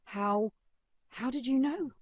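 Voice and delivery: high, stunned stammer; bewildered. Acted. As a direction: stammer in disbelief.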